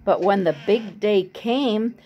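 ordinary delivery, nothing marked

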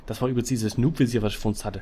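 ordinary talk, nothing marked

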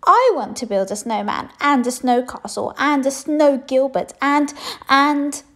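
loud, high, tumbling wish list; eager princess rattling off plans; excited child. A narrator's reading. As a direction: pile up the wishes breathlessly.

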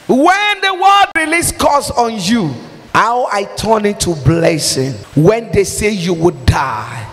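normal talking voice

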